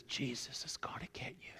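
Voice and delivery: eerie whispered parrot voice. Acted. Parrot whispers its warning to the burglar. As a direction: croak it softly like a sly bird. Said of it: "the parrot looks at him and says".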